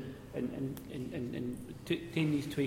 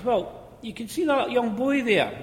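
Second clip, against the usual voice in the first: put-on higher, louder voice of the old miner; cheeky miner voice; 10 semitones higher, 6 dB louder than usual. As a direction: deliver it like a sly pit joker.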